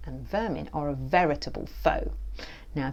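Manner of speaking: usual speaking voice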